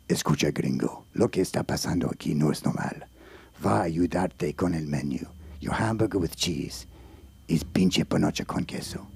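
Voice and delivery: very deep, hushed Mexican character voice; low Mexican voice, coaching a lost tourist; Mexican helper. Acted. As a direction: drop low and confide in the gringo. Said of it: "That's my Mexican".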